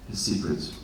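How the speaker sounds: usual speaking voice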